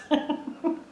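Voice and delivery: high, airless giggle; sheepish giggle about dozing between strangers; breathy giggle. Real, not acted. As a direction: giggle at yourself. Laughing at sleeping on plane.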